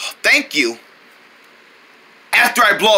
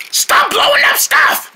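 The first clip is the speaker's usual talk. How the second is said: high strained slow cry; shrill yell. Pretend. Drone screaming at Crowd to stop. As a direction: beg him to stop. Character pleads.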